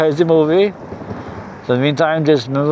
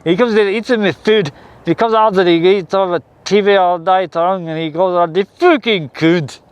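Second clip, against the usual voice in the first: a lightly put-on Scottish accent, ranting; grumbling about a freeloader in a Scottish accent; 4 semitones higher than usual. Ranting about a mooching neighbour in a mild Scottish accent. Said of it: "I'm trying my Scottish accent out".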